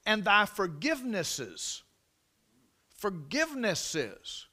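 "forgivenesses" slowed, each syllable drawn out; stress on plural. Shown as a demonstration. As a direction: savour "forgivenesses" slowly.

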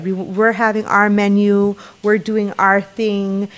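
ordinary talk

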